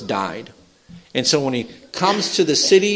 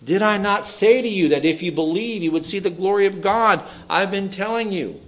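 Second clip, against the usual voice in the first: raised, insistent reminder; a little frustrated; 5 semitones higher than usual. Acted. Jesus reminding doubters what they were told, mildly frustrated. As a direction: remind them with mounting impatience, voice lifted. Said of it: "Does he sound a little frustrated?".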